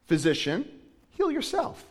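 heal jumps very high in mockery; taunting. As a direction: jeer it, with "heal" shot up high. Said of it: "They were taunting him".

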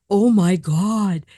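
gasp, then a slow loud "oh my God"; retold awestruck gasp; sudden shocked expression. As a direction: act out jaw-dropped astonishment. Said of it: "all of a sudden this expression".

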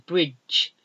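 ordinary talk

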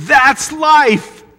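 high, loud starry-eyed gushing; mock longing excitement. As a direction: gush like a dazzled TV viewer. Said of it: "and you think".

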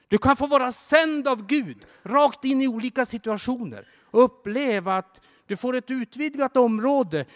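high, loud, soaring exhortation; fervent preaching. Acted. Preacher sends the congregation out, fervent preaching. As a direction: lift the congregation with rising fervour.

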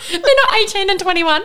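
high-pitched, laughing through the words. Real, not acted. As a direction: say it while cracking up. Laughs at her own slip.

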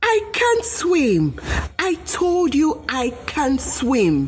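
high, slow frightened pleading; hammed-up panic, drawn out and high; monkey crying out while dunked in the river; mock panicked cry. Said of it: "The monkey cried out".

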